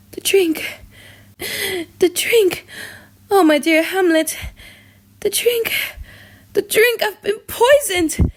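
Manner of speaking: a poisoned queen gasping her warning; dying, desperate. Acted. Dying queen warns Hamlet.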